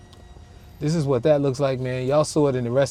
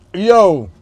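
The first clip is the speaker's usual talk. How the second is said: usual speaking voice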